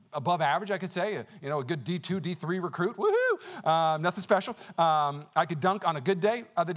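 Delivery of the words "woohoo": high little "woohoo"; mock cheer. Speaker playing down his hoops career, high mock cheer.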